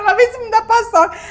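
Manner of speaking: high, loud mock whine through a laugh; retold tearful complaint. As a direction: wail it, half laughing at yourself. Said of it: "eu morrendo de chorar". (queixa chorosa imitada, rindo)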